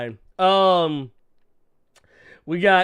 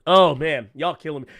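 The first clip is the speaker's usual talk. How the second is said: ordinary talk, nothing marked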